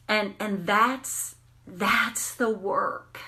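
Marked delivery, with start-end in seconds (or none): none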